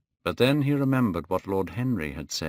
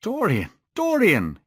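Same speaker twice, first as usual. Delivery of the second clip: high, alarmed call of the name; crying out; 11 semitones higher, 5 dB louder than usual. A narrator's reading. Painter catching Dorian's hand, crying out. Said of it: "he cried".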